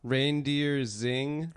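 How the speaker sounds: "zing" said for "xing", slow, with a hard z. Shown as a demonstration. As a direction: read "reindeer zing" slowly, saying "zing" as a word. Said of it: "I used to say".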